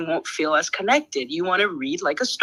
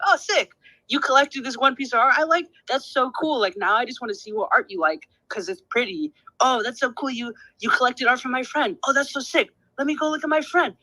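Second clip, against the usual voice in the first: high-pitched delighted gushing; excited gushing; 7 semitones higher than usual. Acted. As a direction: gush proudly over a friend's news. Acts out gushing over friend's collectors.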